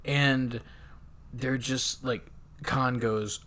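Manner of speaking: ordinary talk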